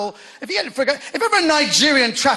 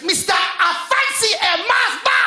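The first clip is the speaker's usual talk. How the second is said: high, slow, over-pronounced foreign name; hammed-up newsreader saying a foreign name; mock news presenter; 10 semitones higher than usual. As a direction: announce the name high and drawn out. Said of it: "B B C news presenters".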